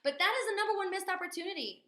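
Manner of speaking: high, fast, peppy pitch; lingering cheerleader energy; still cheerleader mode. Real, not acted. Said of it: "I'm still stuck in that mode".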